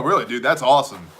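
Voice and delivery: normal talking voice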